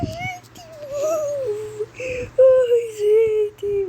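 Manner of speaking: high, loud fake-crying wail; whiny cry. Real, not acted. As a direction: wail it like a dramatic sob. Despair over the campfire. (choro dramático e agudo)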